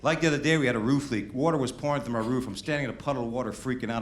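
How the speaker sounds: usual speaking voice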